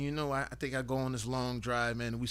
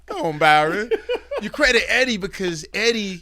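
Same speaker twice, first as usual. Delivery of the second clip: loud, laughing excitement; 7 semitones higher, 11 dB louder than usual. Real, not acted. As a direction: laugh through the words. Excited laughing praise of Eddie.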